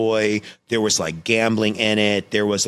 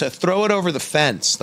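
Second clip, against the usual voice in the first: higher, casual mock dad voice; imitating dad; 11 semitones higher, 3 dB louder than usual. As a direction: toss off the line like an offhand director. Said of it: "He goes".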